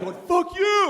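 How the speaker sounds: high-pitched mock angry yell; hammed-up road-rage retort; imitating a furious driver. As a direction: fling it back in high outrage.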